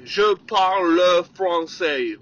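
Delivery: slow, halting French of a foreigner; mock foreign accent; foreign accent imitation. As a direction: mimic an English speaker trying French, slowly. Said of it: "il a dit".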